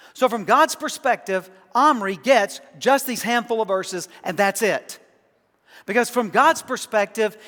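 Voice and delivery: loud, high pulpit push on God's perspective; full-force preaching. Acted. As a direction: drive it home like a preacher at the peak.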